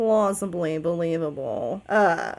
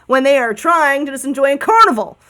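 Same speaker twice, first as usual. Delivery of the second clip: dude-voiced bird; 2 semitones higher than usual. Bird to the cats pestering it at the carnival, aggrieved dude voice. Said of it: "I have to do a dude voice".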